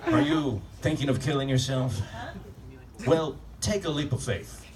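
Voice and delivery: mock a gentle rehab-ad announcer; lightly voiced soothing commercial narrator. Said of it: "The guy's got that soothing voice".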